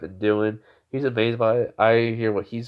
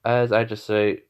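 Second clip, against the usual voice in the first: usual speaking voice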